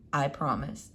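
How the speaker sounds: low, soft, firm dad voice; promising father. Acted. Father promises Flora in a low soft voice. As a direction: settle it quietly like a father.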